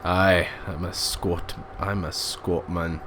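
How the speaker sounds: slow, low mock Scottish accent; attempted Scottish accent. Gamer mimicking the Scottish passengers, mock Scottish accent. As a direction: put on a slow Scottish brogue. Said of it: "I should really just stop though with accents".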